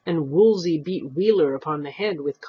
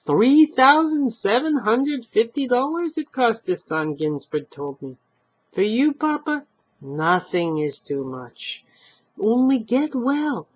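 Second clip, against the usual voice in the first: put-on old man quoting; 7 semitones higher, 5 dB louder than usual.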